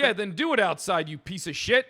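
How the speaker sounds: angry shouting. Real, not acted. Cop yelling at protester.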